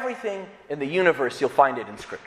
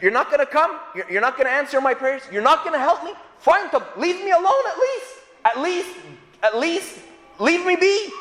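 exasperated Job; 8 semitones higher than usual. Retold or imitated. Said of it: "He's saying".

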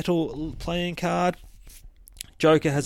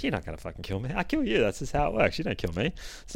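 softer, menacing retold Joker voice; hushed Joker taunt; 8 dB quieter, much faster than usual. Joker taunting Jason, hushed and smug. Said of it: "He's like".

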